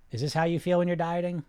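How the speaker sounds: ordinary talk, nothing marked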